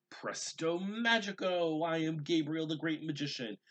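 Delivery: high, loud showy magician proclaiming; grand magician voice. A narrator's reading. Little boy announcing himself to his sister, high showy magician voice. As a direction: puff up like a boy playing the great magician.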